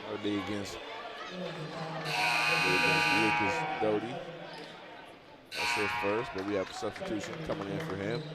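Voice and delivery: brisk basketball commentary; live play-by-play. Acted. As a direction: call the action as it happens.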